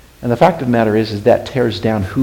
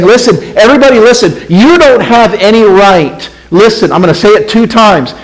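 raised, high-pitched acted call; shouting to sleepy crowd; 9 semitones higher, 7 dB louder than usual. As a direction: rouse a dozing crowd with a loud call. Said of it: "he's shouting out to a sleepy crowd out there".

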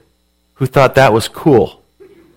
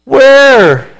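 high, loud puzzled "Where?"; a stranded kid calling into the phone; lost kid calling out; 13 semitones higher, 5 dB louder than usual. Retold or imitated. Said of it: "And he goes".

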